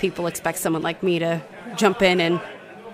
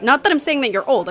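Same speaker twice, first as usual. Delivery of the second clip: flustered backpedal; 7 semitones higher, 7 dB louder than usual. Acted. Flustered backpedal to her idol.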